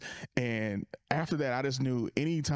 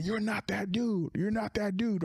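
high-pitched drunk heckler jeer, half-acted; pitched-up imitation of a drunk heckler; mock yell; 9 semitones higher than usual. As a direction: taunt him like a drunk bully, mildly. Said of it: "he's yelling at Sean".

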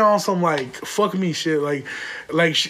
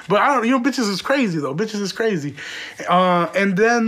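usual speaking voice

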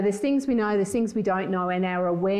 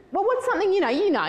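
much higher, pressing retold question; insistent asking; 12 semitones higher, 3 dB louder, faster than usual. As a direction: press him, pitch jumping right up. Retelling her insistence to husband.

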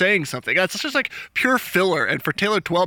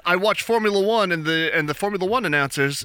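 normal talking voice

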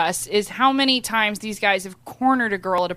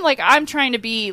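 usual speaking voice

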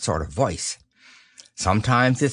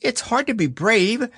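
high, a touch of sniffle; a small pig close to tears, understated; mild sniffling piglet; sniffly Piglet; 12 semitones higher than usual. A narrator's reading. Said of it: "sniffling slightly".

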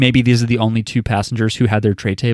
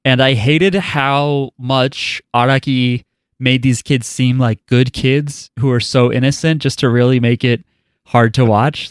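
usual speaking voice

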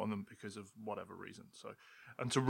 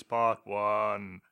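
God voice. Pretend. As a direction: proclaim it like a god. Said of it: "Can you do a God voice".

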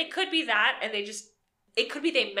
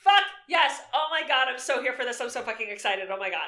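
excited shout; 4 semitones higher, 5 dB louder than usual. Real, not acted. Co-host thrilled at Banshee topic.

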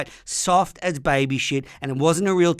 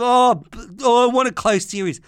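mocking whine; 6 semitones higher, 3 dB louder than usual. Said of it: "Sick of".

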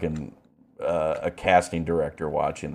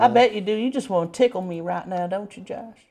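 teasing mock voice; 12 semitones higher than usual. Mocking teasing voice.

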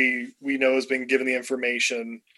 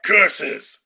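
sputtering foiled villain; 5 dB louder than usual. Retold or imitated. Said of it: "Did, did you want him to shake his fist?".

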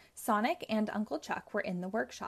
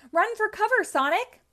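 loud, much higher cry of alarm; urgent cry; 12 semitones higher, 9 dB louder than usual. Acted. Uncle shouts to Sonic while the monster grabs him, loud alarmed cry. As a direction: yell a warning while being lifted up. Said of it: "cried Uncle Chuck".

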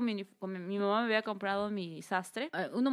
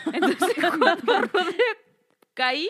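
loud, high, laughing through the words; 7 semitones higher, 7 dB louder, slower than usual. Real, not acted. Telling friend about falling down stairs, laughing. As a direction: struggle to tell it while laughing. Laughs retelling her fall.

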